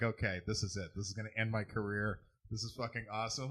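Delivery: gleeful whisper. Pretend. Voicing Gadsby, quiet glee.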